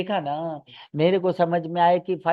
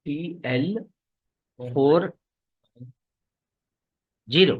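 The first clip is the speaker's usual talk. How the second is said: staccato; 2 semitones lower, slower than usual. Real, not acted. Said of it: "रुक रुक के बोल रहे हैं".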